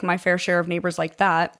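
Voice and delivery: ordinary delivery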